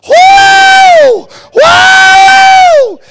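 high, loud preacher's whoops; shout. Shown as a demonstration. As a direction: show them how to shout for joy. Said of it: "let me show you how we do it".

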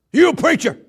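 sharp, high, loud gruff question; big man's challenge. Retold or imitated. Big preacher grilling the pastor at the Y, high and loud.

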